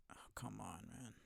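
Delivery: exasperated breathy whisper under the breath; frustrated whisper. Real, not acted. Gamer whispering in frustration at the game, fed-up whisper. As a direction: mutter it to yourself, barely voiced.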